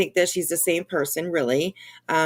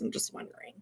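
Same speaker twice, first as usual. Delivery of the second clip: ordinary talk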